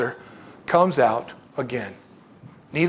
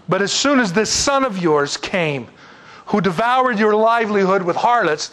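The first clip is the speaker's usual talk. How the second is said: higher, drawn-out accusation; with an attitude; 5 semitones higher, 4 dB louder than usual. Acted. Older son accuses his brother to their father, indignant. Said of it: "have it with an attitude".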